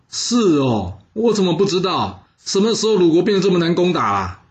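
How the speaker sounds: high-pitched, scoffing disbelief, no real laugh; mocking amusement. A narrator's reading. Minister scoffs at the envoy's claim, mocking amusement. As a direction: cut in with amused, exaggerated doubt. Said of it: "田横就笑着说".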